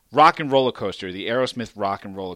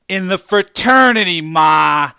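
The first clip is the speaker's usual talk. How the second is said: loud; 7 semitones higher, 13 dB louder, slower than usual. Retold or imitated. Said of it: "And I'm like".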